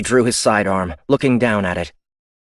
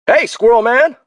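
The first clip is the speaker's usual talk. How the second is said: much higher and louder hail across the hangar; calling out; 9 dB louder than usual. Acted. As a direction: call out to him from far off. Ben Hill calling across hangar.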